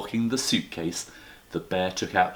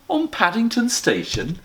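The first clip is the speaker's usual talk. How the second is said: surprised Mrs Brown; 13 semitones higher, 3 dB louder than usual. Acted. Mrs Brown continues disbelieving.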